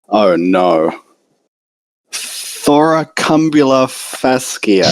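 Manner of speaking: a unicorn mare melting under a massage, drawn-out slow muscle names; blissed-out unicorn. Acted. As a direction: let her sink into the massage, savouring each word. Unicorn's blissful thoughts during massage.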